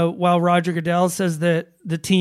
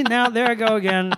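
ordinary delivery

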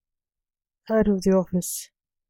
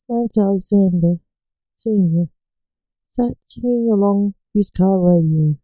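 ordinary delivery

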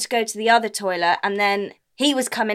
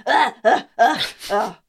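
retching grunts; 4 dB louder than usual. Retold or imitated. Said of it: "literally was like".

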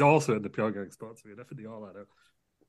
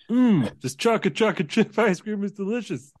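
Joe Biden; 9 semitones higher, 4 dB louder than usual. Acted. Biden eating ice cream.

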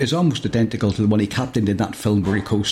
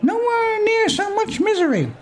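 very high and very slow; very high, slow know-it-all hologram; fourth hologram; much slower than usual. Acted. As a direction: stretch it out in a squeak. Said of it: "says a fourth".

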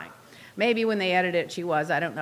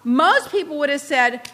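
ordinary delivery

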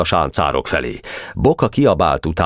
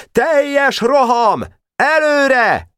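high, drawn-out charge command; final battle order; much slower than usual. A narrator's reading. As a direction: send the troop charging with a high cry. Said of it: "kiadta az utolsó parancsot". (magas, elnyújtott vezényszó)

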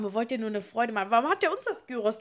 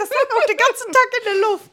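excited retort; 10 semitones higher, 7 dB louder than usual. Retold or imitated. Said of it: "Hab ich gesagt".

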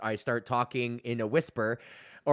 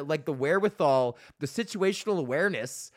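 usual speaking voice

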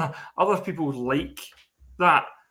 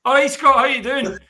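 high, loud mock-friendly hello; chirpy acted greeting; 8 semitones higher, 7 dB louder than usual. Imagining a regular viewer popping up to greet him, high and chirpy. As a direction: hail him loudly in a raised voice.

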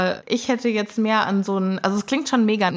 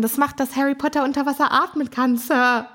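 quick, higher house-elf voice; brisk little elf, pitched up; Dobby; 5 semitones higher than usual. Retold or imitated. House-elf explains the weed to Harry, quick and higher. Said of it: "Dobby sagt dann".

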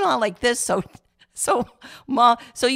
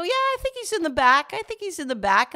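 players answering; 10 semitones higher than usual. Retold or imitated. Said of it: "And they go".

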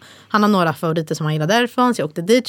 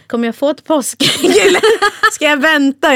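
high, louder question broken by laughter; laughing mock husband; 8 semitones higher, 5 dB louder than usual. Teller laughs while quoting husband's eager Easter egg question. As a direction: ask it while cracking up.

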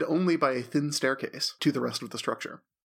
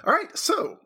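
excited; 4 dB louder than usual. Real, not acted. GM starts the session.

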